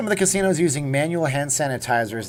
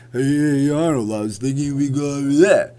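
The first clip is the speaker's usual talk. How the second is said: bad, loud action-star impression; hammy slurred tough-guy voice; Sylvester Stallone; 3 semitones higher, 6 dB louder than usual. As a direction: do a goofy movie-boxer voice, badly. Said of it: "it's really bad on Sylvester Stallone".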